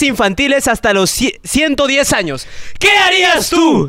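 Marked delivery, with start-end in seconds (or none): 2.8-3.9 hammed-up quiz host; game show host. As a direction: throw the question to the contestant.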